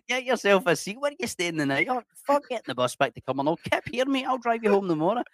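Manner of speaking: high-pitched mock hospitality; overgenerous Scottish stranger. Random local offering a bed for the night, hammed-up eager welcome. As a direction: insist eagerly, playing an absurdly welcoming host. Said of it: "some random people like".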